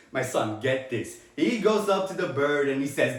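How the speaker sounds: ordinary talk, nothing marked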